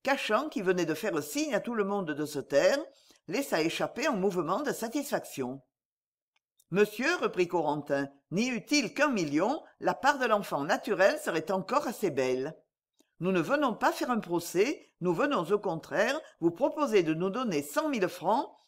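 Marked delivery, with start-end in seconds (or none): none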